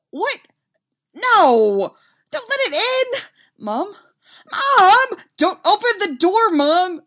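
high, very loud frantic pleading; panicked yelling. A narrator's reading. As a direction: yell in alarm to stop your mom. Son panics as mom lets goose in.

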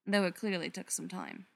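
ordinary delivery

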